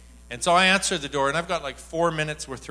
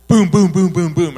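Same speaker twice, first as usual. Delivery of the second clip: loud, booming burst of repeated hits; rapid-fire outpouring; 3 semitones higher, 12 dB louder than usual. Retold or imitated. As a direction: fire it out like an unstoppable spill. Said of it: "she just spills for a, like, a minute".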